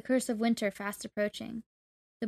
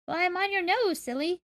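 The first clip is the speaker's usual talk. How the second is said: higher-pitched, perky little cricket; exclaiming creature; 8 semitones higher than usual. A narrator's reading. Tiny voice teasing the boy from his nose, high exclamation. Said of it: "the voice exclaimed".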